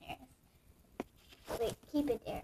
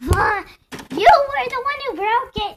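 high, very loud alarmed yell; shocked shout; 11 semitones higher, 16 dB louder than usual. Real, not acted. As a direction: cry out as the toy door breaks. Door breaks, siblings argue.

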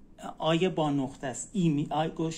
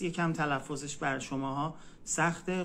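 ordinary talk, nothing marked

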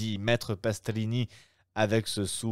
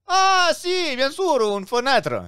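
high, loud excited exclamation; exclaiming; 8 dB louder than usual. Acted. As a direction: burst out with mock enthusiasm. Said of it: "s'exclama maître Pastrini". (exclamation aiguë et forte de l'aubergiste)

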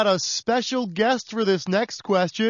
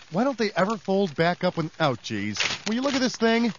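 ordinary talk

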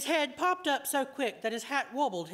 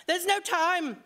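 higher and louder, pressing urgency; breathless urgent warning; urgent alarm; 7 semitones higher, 4 dB louder, slower than usual. A narrator's reading. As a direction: warn her before it's too late. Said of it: "voice trembled with urgency".

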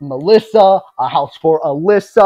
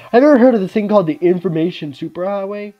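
excited; 6 semitones higher, 7 dB louder, faster than usual. Acted. Character voiced, excited and quick.

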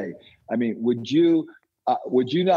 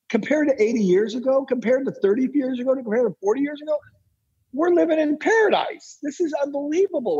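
building excitement; 10 semitones higher than usual. Real, not acted. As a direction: pile up the comparisons, climbing to a peak.

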